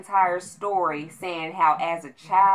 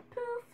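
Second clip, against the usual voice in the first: tiny poof; 12 dB quieter than usual. Acted. Imitating a tiny fart sound.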